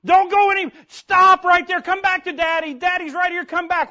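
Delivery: loud, high pleading shout; father calling his toddler back from the road; yelling. Retold or imitated. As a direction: shout to make him stop, then coax. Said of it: "I'm yelling".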